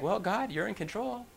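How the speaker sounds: meek imitated prayer; unresisting acceptance. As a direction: yield gently, without a fight. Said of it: "These guys weren't resisting".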